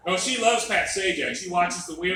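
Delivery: ordinary talk, nothing marked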